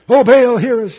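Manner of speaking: loud, high cry to a god; calling out. Acted. Priests of Baal calling on their god, loud call. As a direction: belt out the plea.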